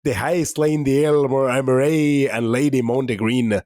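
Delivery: a hint of Scottish brogue reading an old ballad; bad Scottish accent. Host recites ballad to co-host in a mild Scottish accent. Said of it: "My bad Scottish accent".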